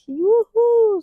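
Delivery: high, loud, drawn-out yoo-hoo; gleeful whoop. Retold or imitated. Newcomer celebrates that she can skip studying, gleeful whoop. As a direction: let out a jubilant cheer, far above your talking voice. Acts out her old joy at skipping study.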